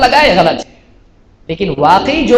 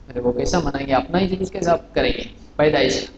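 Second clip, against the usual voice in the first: 1 mock aside; 8 semitones lower, 8 dB quieter than usual. Mocking rhetorical aside.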